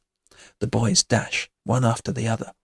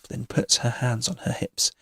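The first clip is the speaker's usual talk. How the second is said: ordinary delivery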